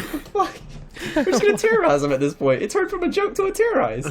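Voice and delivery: high, half-laughing disbelief; incredulous protest. Retold or imitated. Driver objecting to terrorizing him, mock incredulous. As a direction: retell your objection in a high pitch. Retold protest at repeating the prank.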